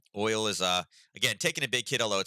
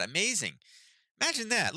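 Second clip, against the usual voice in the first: much higher, mocking wonder; sarcastic praise; 12 semitones higher than usual. Real, not acted. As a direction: pretend to be amazed, but sneer. Sarcastic at EU oil hypocrisy.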